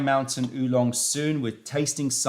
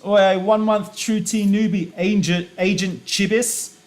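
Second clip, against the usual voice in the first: hyped greeting; 7 semitones higher, 6 dB louder than usual. Acted. Host hypes a chat newcomer.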